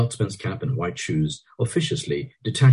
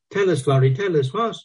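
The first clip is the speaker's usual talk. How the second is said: put-on eager girl; 11 semitones higher, 4 dB louder than usual. A narrator's reading. Kitty eager.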